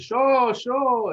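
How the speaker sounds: loud, high catchphrase impression; drawn-out, high-pitched mimicked call; Ak Raw Money. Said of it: "He's the guy that says".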